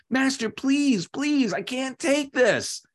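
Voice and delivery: high-pitched, hammed-up plea; begging, pleading. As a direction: beg the master desperately, pitched high. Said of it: "I'm begging, pleading to the master".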